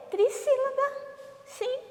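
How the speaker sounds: very high pupil voice; pupil's answer. Acted. Pupil answers what three syllables are called, high put-on voice.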